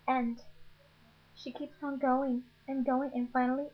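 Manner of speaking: usual speaking voice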